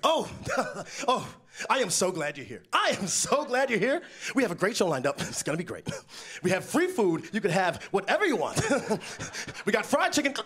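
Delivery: over-eager nervous welcome with awkward giggles; flustered host. Retold or imitated. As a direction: gush at the guest, laughing nervously. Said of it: "they just completely fall apart every time they talk to me".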